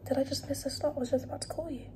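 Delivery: whispering. Real, not acted. Late-night whispered talk.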